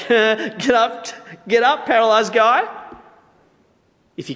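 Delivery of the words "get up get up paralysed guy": loud, high mock jeer; cruel taunting. Bystander ordering a paralysed man to rise, taunting. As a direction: jeer it with a scoffing laugh before it.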